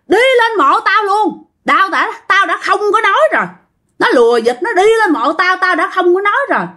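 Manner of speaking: high-pitched indignant scolding; aggrieved old ghost. Retold or imitated. Plays possessed man as angry ghost. (giọng ông già ma trách mắng, cao và bức xúc)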